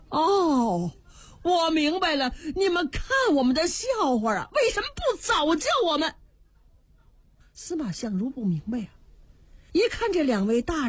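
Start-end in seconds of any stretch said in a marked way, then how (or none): 0.1-6.1 indignant outburst. Acted. Official realizes she was mocked.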